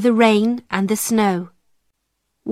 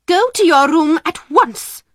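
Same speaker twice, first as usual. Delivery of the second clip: higher, louder sharp command; angry order; 7 semitones higher, 4 dB louder than usual. Acted. Headmistress ordering the servant girl to her room, sharp and angry. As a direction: send her off at once, furious. Miss Minchin orders Sarah away.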